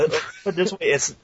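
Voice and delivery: usual speaking voice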